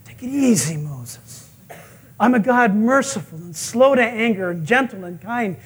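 soothing, patient reply; gentle, hushed reassurance; God. Retold or imitated. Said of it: "And God says".

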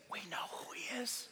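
breathy hushed aside; whisper. Real, not acted. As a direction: lean in and whisper it. Preacher drops to whisper.

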